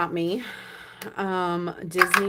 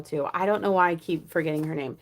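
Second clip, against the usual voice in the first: usual speaking voice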